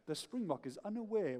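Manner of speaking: softly voiced wildlife narrator; a hint of hushed documentary voice-over; David Attenborough. [name] continues the wildlife programme voice-over, lightly hushed. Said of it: "you've got David Attenborough's voice in the background".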